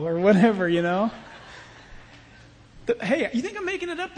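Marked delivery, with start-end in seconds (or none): none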